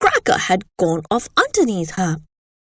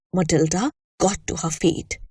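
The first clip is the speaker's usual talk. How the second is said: usual speaking voice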